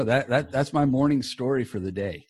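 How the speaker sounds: ordinary talk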